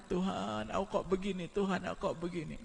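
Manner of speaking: soft, high plaintive cry to God; hushed tearful plea; mock weeping plea. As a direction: act out a sinner whimpering to the Lord. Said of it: "nangis kepada Allah".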